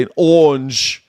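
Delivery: English-style "orange", the r softened into a long "aw"; British "awnge" for "orange"; English accent. Shown as a demonstration. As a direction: draw out "orange" as the English might. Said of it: "I think they would say".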